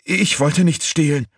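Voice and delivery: high, loud frightened protest; stammering. A narrator's reading. Accused woman answering her staring master, stammering high. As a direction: stammer out a panicky denial. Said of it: "stammelte sie".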